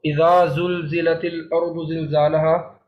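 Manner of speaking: slow recitation, "th" as in "this" in اذا, z in زلزلت; distinct dhal and za. Shown as a demonstration. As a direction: draw out اذا زلزلت, keeping both sounds apart. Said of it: "ہم نے زال پڑھا اور پیچھے آگے". (اذا کی ذال اور زلزلت کی زا الگ رکھ کر ٹھہر کر پڑھیں)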